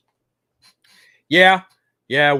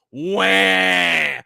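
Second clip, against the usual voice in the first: mock baby wail; 5 semitones higher, 7 dB louder than usual. Mocking crying fans.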